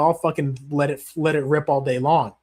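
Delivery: ordinary talk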